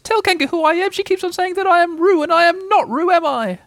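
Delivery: very high, pleading squeal; squeaky, indignant appeal; crying out. A narrator's reading. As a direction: plead urgently in a tiny creature's voice. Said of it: "cried Piglet".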